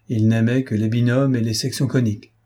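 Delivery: usual speaking voice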